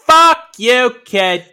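New York tough guy slipping into British, slow and high; hammed-up New York-British mashup heckle; botched New York accent. Boston and New York fans trading insults, in a muddled New York-British accent. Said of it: "I just made New York British".

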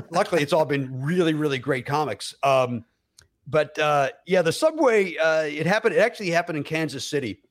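usual speaking voice

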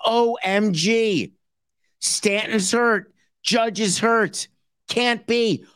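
high, slow mock shock, O M G drawn out; mock surprise. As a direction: ham up the owner's disbelief. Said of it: "the surprise they show".

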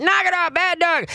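hammed-up shout at the dog; imitating a yelling owner. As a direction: play the frazzled owner scolding. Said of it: "when he starts screaming".

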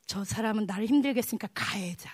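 low, hushed self-talk; acted murmur trailing toward a whisper; inner self-justification. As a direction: confide it softly to yourself.

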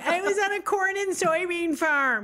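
put-on high, earnest country-girl voice; lightly hammed farm girl; farm girl innocence. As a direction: say it proudly and naively. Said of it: "I was like".

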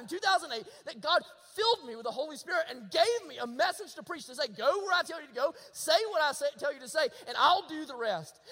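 fervent preacher building in force; impassioned preaching. Real, not acted. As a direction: drive it home to the congregation.

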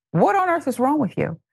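What on earth is wrong with you said high and incredulous; exasperated disbelief. Real, not acted. Host to Haley, high incredulous outrage. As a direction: pitch jumps up high in disbelief. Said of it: "repeated louder exasperated question".

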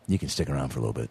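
very low, quick, understated invitation; mock-cool low murmur of approval; smooth host act. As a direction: drop your voice low and toss it off. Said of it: "I go".

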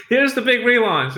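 high-pitched, proudly heralded reveal; big announcement. Retold or imitated. Chef presenting his relaunch to the town, mock fanfare.

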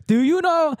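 put-on high, distressed Indian-accented plea; imitated Indian accent. Job seeker asking the storyteller, imitated Indian accent. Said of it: "I'll do the voice".

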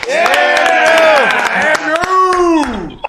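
high, loud stadium whoop; crowd cheer. Pretend. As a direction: cheer his name like a fan. Cheering the guest's entrance.

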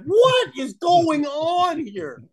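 high, very slow, drawn-out stunned voice; a commentator who can't believe what he is seeing; stunned disbelief. Retold or imitated. Broadcasters reacting to a brawl.

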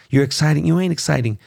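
usual speaking voice

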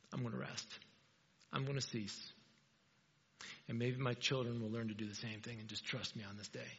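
a hushed, content God voice; calm resting God. Pretend. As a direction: let God wind down to rest. God decides to rest.

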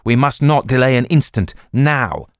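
no time to lose, press hard; urging his friend to act at once, desperate; desperate urgency. Acted. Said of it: "he said desperately".

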